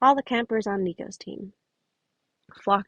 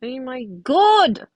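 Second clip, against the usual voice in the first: exasperated exclaim; 5 semitones higher, 5 dB louder, much slower than usual. Real, not acted. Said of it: "I can't do a country accent".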